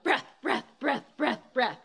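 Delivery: short, sharp repeated bursts; rapid-fire pumped breaths; fast breath demo. Shown as a demonstration. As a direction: punch out each breath in quick succession. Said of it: "It's gonna be like".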